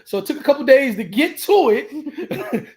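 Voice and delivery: laughing while he tells the hosts how long it took. Real, not acted. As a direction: laugh while you explain, slowing down. Laughing through retelling.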